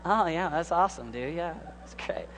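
mock glazed-smile agreement, fading to a murmur; empty polite yeah-yeah, trailing off quietly; polite fake enthusiasm. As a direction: brush them off with a hollow yes, yes. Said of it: "the person with the glazed smile".

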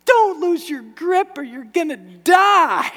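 high, loud, slow squeal of terror; comic panicked baby voice; panicked baby monkey. Retold or imitated. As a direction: play the clinging baby monkey begging for its life. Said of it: "the baby monkey's going".

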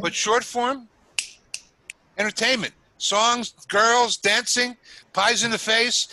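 high and loud, hyped up; excited. Real, not acted. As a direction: sell it like a vaudeville barker. Hyping vaudeville entertainment.

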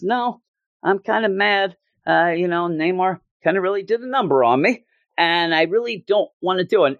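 hammed-up sulking complaint; whiny Jim. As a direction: play a pouting writer, high and put out. Jim whining retold.

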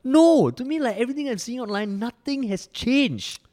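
fed-up rhetorical shout; hotheaded shouting. Real, not acted. Heated rant about divided internet.

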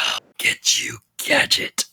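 raspy whispered villain threat; cartoon villain whisper. Shown as a demonstration. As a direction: hiss it like a cartoon bad guy. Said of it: "picture the bad guy from Inspector Gadget's voice".

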